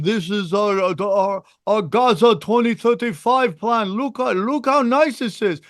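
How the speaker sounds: lightly voiced Netanyahu selling a plan; Netanyahu impression. Netanyahu pitching Gaza plan to the world, lightly voiced. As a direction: pitch a real estate dream like Netanyahu. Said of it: "Netanyahu going".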